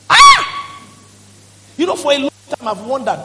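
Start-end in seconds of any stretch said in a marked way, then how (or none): 0.1-0.8 sudden very high, loud cry of Ah; high shout. Real, not acted. Preacher cries out to congregation with a high, loud Ah. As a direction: burst out with a piercing Ah.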